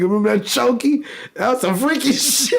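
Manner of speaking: high, laughing through the words. Real, not acted. Laughing while recalling.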